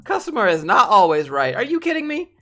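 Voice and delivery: loud, rising indignant outburst; indignant rant. Real, not acted. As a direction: let the disbelief shoot the voice up on the question. Ranting about customers.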